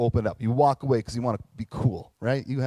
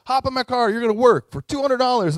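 pushy boss recruiting; 11 semitones higher, 8 dB louder than usual. Acted. Owner recruiting workers.

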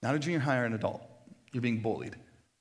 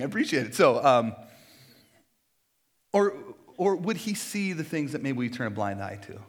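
ordinary talk

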